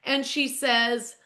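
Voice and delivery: usual speaking voice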